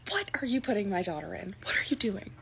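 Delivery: soft unvoiced whisper; hushed aside. [name] whispering to the bridal clerk behind her daughter's back. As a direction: whisper it so the daughter won't hear.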